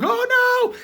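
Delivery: very high, loud cry; hammed-up falsetto scream; mock horror. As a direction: shriek like a shocked crowd. Crowd reacts to shooting.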